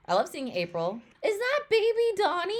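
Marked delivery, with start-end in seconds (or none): 1.2-2.5 high, loud, delighted squeal; excited squeal. Real, not acted. Fan spotting baby Donnie on screen, squealing.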